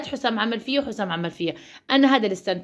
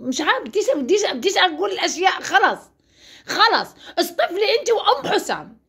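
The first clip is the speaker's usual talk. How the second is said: exasperated outburst; 9 semitones higher than usual. Real, not acted. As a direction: blow up in frustration. (انفجار غضب وزهق بصوت عالٍ)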